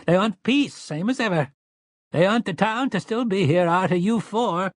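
put-on high, shrill old storekeeper voice; shrill shards-of-glass voice. A narrator's reading. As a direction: pitch it high and piercing like broken glass. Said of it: "in his shrill shards of glass voice".

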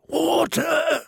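high, thin gasp for water; gasped plea. Acted. Bearded prisoner gasps to the girl for water. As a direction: gasp it out, parched.

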